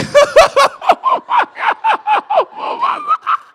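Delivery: high, loud howl of grief; hammed-up crying wail; wailing mock sob. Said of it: "I'm like".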